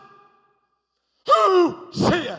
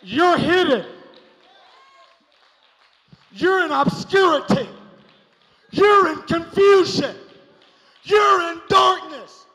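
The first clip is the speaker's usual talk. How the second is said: preaching build; 4 semitones higher, 5 dB louder than usual. Acted. Building sermon lines.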